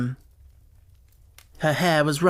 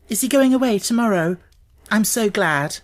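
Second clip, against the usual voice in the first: higher, a bit louder, quick and glad; eager relief; 6 semitones higher, 4 dB louder than usual. A narrator's reading. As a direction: let relief burst out. Mary relieved.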